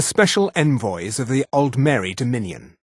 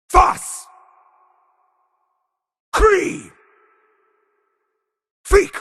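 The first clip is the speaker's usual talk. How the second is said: high, explosive single-word shouts; full shout; 5 dB louder, much slower than usual. Acted. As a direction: hurl each word out, pitch falling away. Isolated battle shouts in game.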